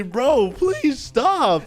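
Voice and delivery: very high, hammed-up begging; dramatic pleading. Bullied victim to bully, dramatic pleading. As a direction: beg as if near tears, overdone.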